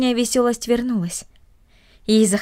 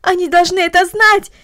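upset pleading; 8 semitones higher, 4 dB louder than usual. Acted. Girl pours out to Marta.

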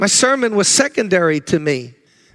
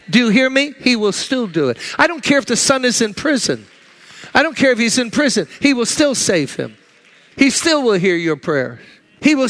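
higher, fervent preaching; rising preaching; 4 semitones higher than usual. Real, not acted. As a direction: build urgency to the congregation. Preacher rises to full force.

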